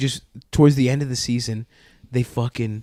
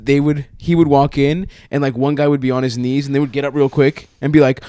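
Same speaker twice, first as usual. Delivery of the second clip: usual speaking voice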